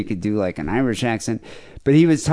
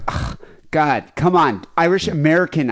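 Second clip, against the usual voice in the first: louder, higher mock exasperation; imitated exasperated girl; 6 semitones higher, 4 dB louder than usual. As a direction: do an impatient casting girl, fed up and pushy. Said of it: "this twenty-six-year-old girl was just like".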